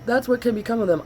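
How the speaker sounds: talking through a yawn; words stretched by a yawn. Real, not acted. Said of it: "I am so sorry that I'm yawning".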